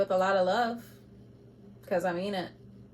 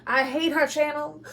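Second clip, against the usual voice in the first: higher, mocking voice of an angry viewer; mock hater quote; 6 semitones higher, 4 dB louder than usual. Tarot reader mocking an angry viewer, higher and sneering. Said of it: "it's gonna be like".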